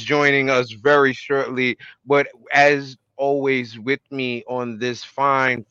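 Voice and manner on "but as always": ordinary talk, nothing marked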